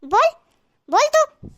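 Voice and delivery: put-on sharp scolding of a child; angry scolding. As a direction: snap at the little girl. (गुस्से में डांटना)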